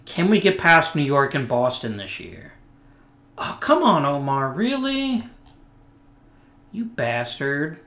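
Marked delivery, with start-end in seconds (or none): 3.4-7.8 exasperated. Real, not acted. Player injury frustrates him.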